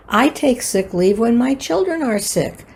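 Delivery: normal talking voice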